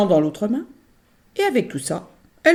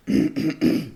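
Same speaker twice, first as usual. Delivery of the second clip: throat clearing. Acted. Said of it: "Logresse s'éclaircit la gorge". (raclement de gorge)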